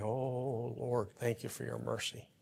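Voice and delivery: soft, hushed prayer of thanks; congregant's soft thankful prayer; quiet prayer. Pretend. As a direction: murmur it like a private prayer. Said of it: "you're going".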